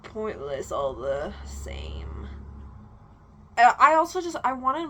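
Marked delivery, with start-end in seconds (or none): 0.0-2.3 slow, hushed whisper; unvoiced, slow whisper under her breath; whispered aside. Real, not acted. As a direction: whisper it slowly as a snarky aside.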